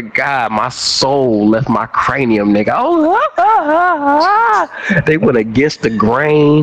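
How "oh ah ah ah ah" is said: pained yelp. Retold or imitated. Clippers on scalp, soul left.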